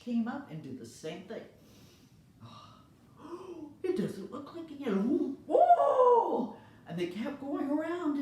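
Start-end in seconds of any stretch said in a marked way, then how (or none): 3.2-3.8 gasp. Acted. Kids gasp at the candy. 3.8-6.6 put-on high, loud, amazed kid voices; excited kids. Kids marvel at the candy, high and thrilled.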